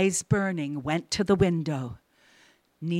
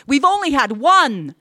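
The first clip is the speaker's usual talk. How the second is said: insistent; 8 semitones higher, 8 dB louder than usual. Acted. Wife insists.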